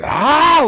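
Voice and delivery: sharp high cry of pain, imitated; short pained scream, retold; mock pain scream. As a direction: yelp it like an old woman in pain. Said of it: "she's legit screaming in pain".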